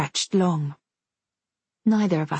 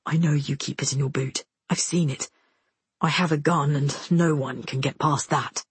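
lightly voiced man, pitched a little down; low, measured warning; soldier's low voice; 4 semitones lower than usual. A narrator's reading. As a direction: keep it low and controlled. Soldier quietly warns frightened girl.

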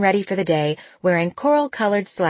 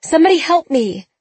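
desperate cry for help; 10 semitones higher, 6 dB louder than usual. A narrator's reading.

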